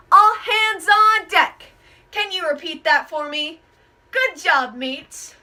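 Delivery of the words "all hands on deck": loud, slow hail across the deck; captain's call. Shown as a demonstration. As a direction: bellow it to call the whole crew. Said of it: "what I said when I called you up here".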